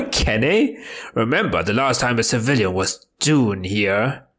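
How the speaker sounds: gruff Scottish governor, lightly voiced; a hint of a burly Scottish jailer; Scottish accent. Game player voicing the prison governor in Scottish. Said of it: "how do I voice him?".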